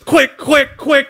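high, urgent mock panic; frantic coach caught off script. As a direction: hurry yourself in a hammed-up fluster. Mock panic in acted quote.

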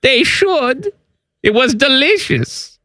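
high, slow fake German accent; chatty German tourist voice, high and slow. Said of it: "Your German accent".

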